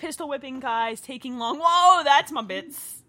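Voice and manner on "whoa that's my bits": loud, high, drawn-out protest; startled outburst. Real, not acted. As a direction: cry out slowly in surprise. Startled reaction to film action.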